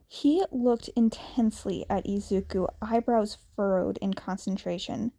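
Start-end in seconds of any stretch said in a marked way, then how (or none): none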